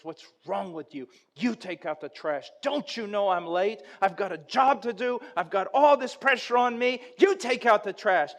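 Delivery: an annoyed husband's complaint rising into a loud protest; irritated retort. Retold or imitated. Husband snaps at wife.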